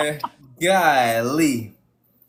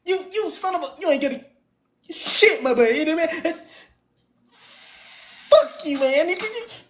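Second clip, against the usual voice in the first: high, fake-crying, stammering whine; mocking a crashing-out caller; 10 semitones higher than usual. Host parodies the caller's outburst with high mock crying. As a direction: overplay the upset man's sobbing complaint.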